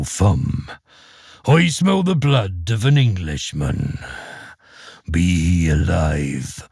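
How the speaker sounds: deep, slow booming ogre; giant ogre voice. Acted. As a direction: growl it out like a giant. Ogre voice in story.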